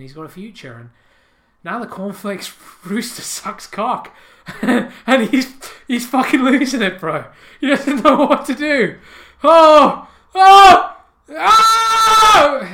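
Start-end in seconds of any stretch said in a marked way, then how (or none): 1.6-9.0 louder, higher, cracking up mid-sentence; can barely get the words out for laughing; laughing hard. Real, not acted. Comic telling his podcast audience, laughing hard. Losing it at the rooster news. 9.4-12.7 high, very loud drawn-out cries; anguished wail. Acted. Comic wailing as the bewildered cornflake eater.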